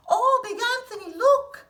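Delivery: high, loud startled cry rising on look; alarmed cry. Acted. The convent sister warns Big Anthony, high alarmed cry. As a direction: gasp it out as you spot the flood. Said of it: "a sister from the convent said".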